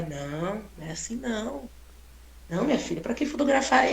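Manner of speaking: put-on a shy old lady declining; soft modest refusal. Old lady declining to be photographed, soft and modest.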